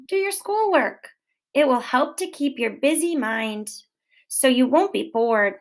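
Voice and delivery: ordinary delivery, nothing marked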